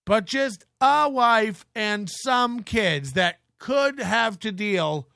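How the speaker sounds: ordinary delivery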